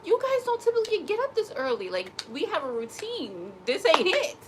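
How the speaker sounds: high, pet-talk voice scolding the animals; mock-scold the pets in a high voice. Owner scolding her pets about their routine, in a high, put-on voice.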